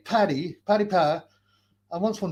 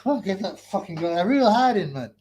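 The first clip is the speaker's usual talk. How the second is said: normal talking voice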